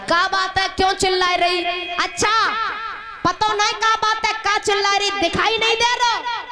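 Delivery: high-pitched puzzled mother; puzzled Yashoda. Acted. (ऊँची आवाज़ में हैरान यशोदा)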